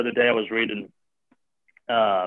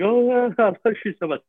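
relieved love; 10 semitones higher than usual. Retold or imitated. Relieved father hugging son.

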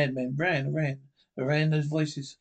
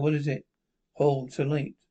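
normal talking voice

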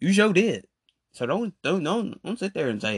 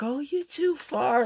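much higher, slow mocking girl; mock high quote; 14 semitones higher than usual. Quoting Jennifer's excuse, much higher and slow.

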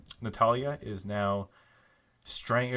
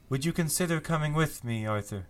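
lightly higher, coaxing invitation; coaxing Loriana; 5 semitones higher than usual. Acted. GM as Loriana tempting Arthur to leave with her. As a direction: lure him gently to her side. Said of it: "she turns to Rob and says".

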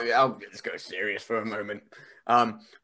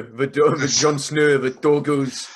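loud mock-Nordic accent; Scandinavian twinge; 5 dB louder, slower than usual. Wrestler playing on Jon Snow and wolves, Scandinavian accent. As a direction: boom it in a fake Scandinavian accent. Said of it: "they sound like wolves".

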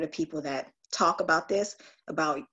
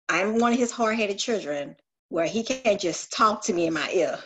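ordinary talk

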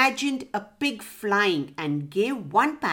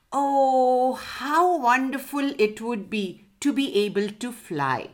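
dreamy wonder; 4 semitones higher than usual. A narrator's reading. Pig daydreams of flying, dreamy wonder. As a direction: marvel wistfully at the idea.

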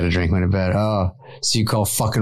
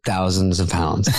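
normal talking voice

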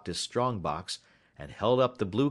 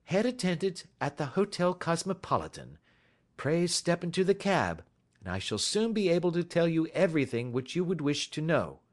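assured detective, pitched up; Sherlock Holmes; 9 semitones higher than usual. Acted. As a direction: usher him along with brisk courtesy. Voices Holmes.

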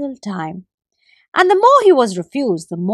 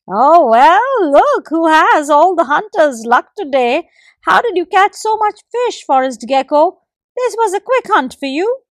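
loud, high teasing squirrel voice; mock him playfully; 6 semitones higher, 5 dB louder than usual. A narrator's reading. Squirrel teases the gecko, loud and high. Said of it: "teased the squirrel".

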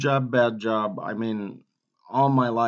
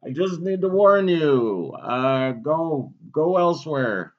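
put-on voice; 6 semitones higher, 8 dB louder than usual. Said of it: "I just was doing a fucking voice".

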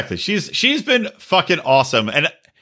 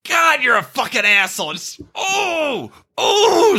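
frustrated outburst; 10 semitones higher, slower than usual. Retold or imitated. Julia's exasperated retort, voiced.

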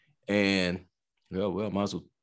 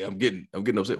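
usual speaking voice

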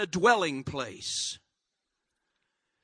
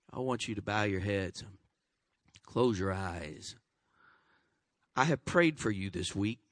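low, soft invitation to pray; gentle closing prayer voice; soft altar call; 9 semitones lower, 7 dB quieter than usual. Acted. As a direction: hush the room for prayer. Pastor leads closing prayer.

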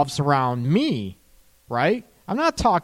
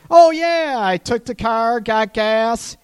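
oblivious partner; 8 semitones higher, 6 dB louder than usual. Retold or imitated. Acted oblivious partner answer.

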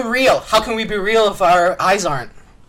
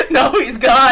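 higher, louder, drawn out through real laughter; laughing; 5 semitones higher, 5 dB louder, slower than usual. Real, not acted. Host realizes the caller has left, laughing. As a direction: say it while cracking up.

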